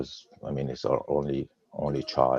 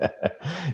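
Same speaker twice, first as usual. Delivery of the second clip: a short, bright burst of laughter, much higher; 13 semitones higher, 3 dB quieter than usual. Real, not acted. Guest laughing as the host says he barely notices the stutter. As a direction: laugh with relief.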